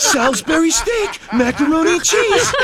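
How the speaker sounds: a buddy-buddy school announcement; Mark Wahlberg. Pretend. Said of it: "Mark Wahlberg".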